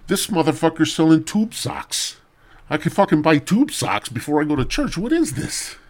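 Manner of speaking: high-pitched, incredulous churchgoer; acted bafflement. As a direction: protest in mock disbelief, pitch climbing. Acted churchgoer baffled by flea market.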